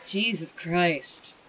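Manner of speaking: usual speaking voice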